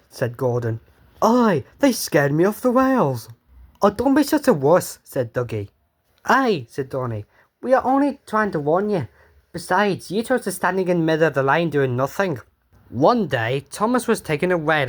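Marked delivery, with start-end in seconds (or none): 1.2-12.4 put-on higher, cocky young engine voice with a Scots lilt; cheeky Scottish engines. Twin engines mocking Gordon and Henry, high and brash.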